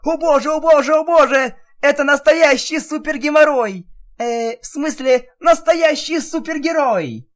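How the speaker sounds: very high, loud, breathless gushing; frantic fangirl squeal. Acted. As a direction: gush at your hero. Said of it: "остервенело затрясла, приговаривая".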